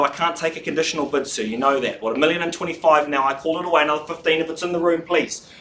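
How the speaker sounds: normal talking voice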